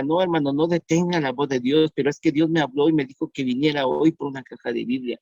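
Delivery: normal talking voice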